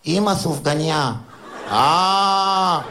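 put-on slow little-girl voice asking; a small child drawing out her wish; child's request. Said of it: "אמרה לה". (בקשה של ילדה קטנה, איטית)

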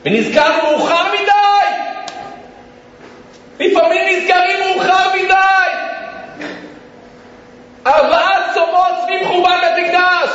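high, ringing preacher's cry; full-force preaching. Real, not acted. Preacher warning those who remember too late, full-force preaching. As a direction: proclaim it as a dire warning.

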